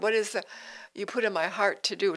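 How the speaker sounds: ordinary talk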